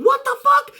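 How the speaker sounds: squeaked way up high, stunned; a sudden high-pitched what-the-fuck of disbelief; shocked disbelief. Retold or imitated. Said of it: "which I was going".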